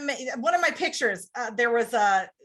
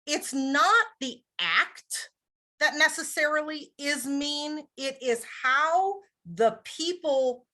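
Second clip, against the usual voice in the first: ordinary talk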